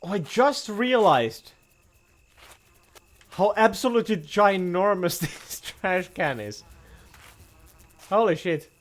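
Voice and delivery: normal talking voice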